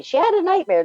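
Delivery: sister's voice pitched high. Retold or imitated. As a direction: chirp it high like an older sister. Sisters soothing scared child.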